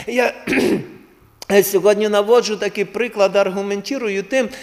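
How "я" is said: ordinary talk, nothing marked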